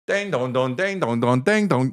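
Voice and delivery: sung ding dong rising at the end; mock horror sound effect, climbing up; Michael Myers sit-up noise. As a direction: chant the creepy movie sit-up sound. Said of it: "when he's like".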